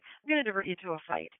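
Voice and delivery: usual speaking voice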